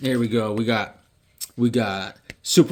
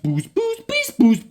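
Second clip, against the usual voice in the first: very high rising boosts; transformation noise; slower than usual. Retold or imitated. Cyborg Superman forming, high transformation noise. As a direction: power up like a cartoon transformation.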